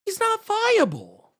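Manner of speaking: high, slow, sneering "He's not viable."; mocking parroted line. Streamer mocking the editor's claim, slow high sneer.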